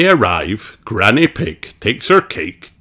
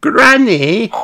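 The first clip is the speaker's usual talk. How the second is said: put-on much higher, louder little girl voice; a bright piglet explaining; Peppa's voice; 6 dB louder than usual. Peppa explaining the walk to Granny, high and chirpy. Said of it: "says Peppa".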